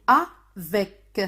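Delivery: "Avec" split into A, then VEC; syllable by syllable. Shown as a demonstration. Teacher introducing an example word, "Avec" split in two syllables. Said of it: "Tapez dans vos mains et dites-moi".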